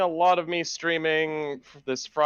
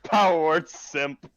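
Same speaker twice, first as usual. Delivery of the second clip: mock spell cast; 4 semitones higher, 4 dB louder than usual.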